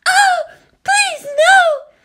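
very high pleading scream; loud pleading. A narrator's reading. Captive woman feigning victimhood while attacking, loud pleading. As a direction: wail it out at full volume. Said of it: "let out a loud, pleading".

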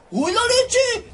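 high, slow startled cry; alarmed exclamation. Real, not acted. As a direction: draw it out high in shock. Alarm during game mishap. (exclamación aguda de susto)

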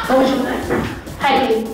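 ordinary talk

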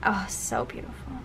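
hushed, falling gush; quiet whisper of delight; whispered admiration. Real, not acted. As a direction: sigh it out in a whisper. Admiring her baby shower spread.

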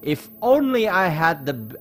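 normal talking voice

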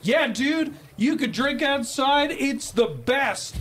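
imitating a drunk dude, high-pitched; drunk tourist voice. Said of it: "this guy goes".

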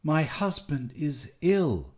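plain reading voice

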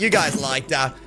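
ordinary talk

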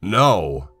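put-on a loud, stubborn old man's No; old grandfather cutting his grandson off with a loud no; sharp shout of refusal. A narrator's reading. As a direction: bark the refusal out at the boy. Grandfather refuses Sean at door.

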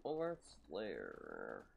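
low, very slow drawn-out title; ominous announcement. Pretend. Player announcing the vampire lair, slow and low. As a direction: intone the lair name like a trailer.

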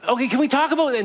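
high, awkward fumbling as Peter; flustered Peter. Retold or imitated. Peter fussing at breakfast, high and awkward. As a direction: pitch way up, nervously flustered. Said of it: "And it just".

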